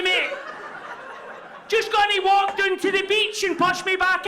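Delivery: high, loud frantic pleading to a passer-by; stranded mermaid shouting after a man heading to his car; washed up baby mermaid. Pretend. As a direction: beg a man walking away to push you back into the sea. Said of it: "impression of a washed up baby mermaid".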